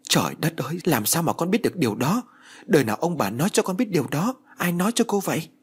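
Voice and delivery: rising, flustered questions, a touch of panic; alarmed stammering. A narrator's reading. Nanny confronted with the adoption secret, mildly panicked stammer. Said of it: "mặt tái xanh lắp bắp nói".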